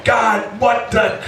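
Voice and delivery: exasperated yell at God. Retold or imitated. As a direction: shout it up to the sky. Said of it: "I'll be just yelling".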